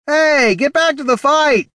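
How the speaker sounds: high, loud heckler from the crowd; calling out spectator. A narrator's reading. Spectator heckles the duelists, high shouted call. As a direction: yell it from the stands, high and slow. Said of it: "called a spectator".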